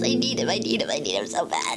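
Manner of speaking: desperate longing. Real, not acted. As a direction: moan out the need from deep down. Desperate longing for floor-tile guy.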